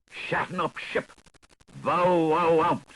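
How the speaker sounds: barking dog voice. Acted. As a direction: bark it out like a big dog. Barking outburst in the tale.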